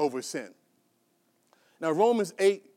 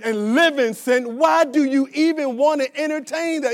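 rising preaching; 4 semitones higher, 5 dB louder than usual. Acted. Preacher builds rebuking questions.

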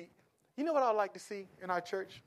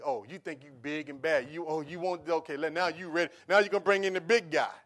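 taunting challenge; 8 dB louder than usual. Retold or imitated. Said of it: "they think automatically".

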